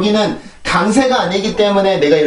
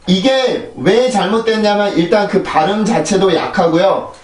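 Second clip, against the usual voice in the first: ordinary talk, nothing marked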